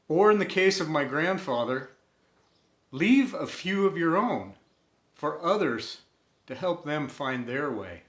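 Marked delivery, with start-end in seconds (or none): none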